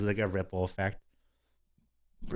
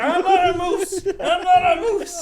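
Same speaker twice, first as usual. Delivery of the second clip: much higher, slow, laughing chant; laughing mantra; 8 dB louder, slower than usual. Retold or imitated. Telling himself he's not a moose, high and laughing. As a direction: chant it to yourself through laughter.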